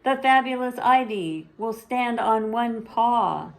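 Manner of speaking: high, loud big-top announcer; a little girl playing ringmaster; showy announcer sister. Zelda announces the show.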